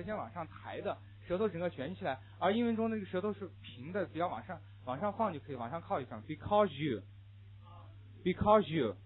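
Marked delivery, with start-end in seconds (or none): 6.2-9.0 "Because you" blended into becau-zhu; linked zh sound. Shown as a demonstration. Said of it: "记住这种音变".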